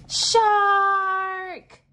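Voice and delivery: high, loud alarmed cry; dramatic shout. Acted. Teacher acting out the shark's arrival, loud shout. Story climax, shark appears.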